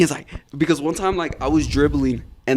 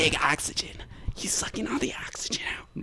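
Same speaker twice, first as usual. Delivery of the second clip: higher whispered tease; teasing whisper; 5 semitones higher, 11 dB quieter than usual. Retold or imitated. Coach teases a player, a high teasing whisper. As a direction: hiss it like a sly joke.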